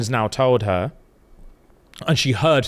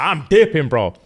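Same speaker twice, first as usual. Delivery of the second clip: excited exit; 4 semitones higher, 5 dB louder than usual. Retold or imitated. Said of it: "she's like".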